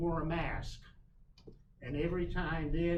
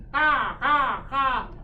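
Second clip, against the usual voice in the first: loud, high crow caws; three loud cawing cries; crow caw imitation; 8 semitones higher, 11 dB louder, much slower than usual. Imitates the crow's call.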